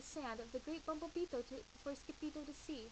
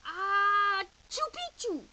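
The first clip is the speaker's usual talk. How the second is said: much higher, loud sneeze on the word; sneezed password; 8 semitones higher, 13 dB louder, much slower than usual. Acted. Cat sneezing the secret password into Don Diego's ear. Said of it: "half sneezed".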